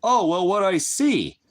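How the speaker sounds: high, slow mocking voice of the critic; mocking quote. Mimicking a commenter's excuse, high and mocking. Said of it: "And you're gonna sit there and tell me".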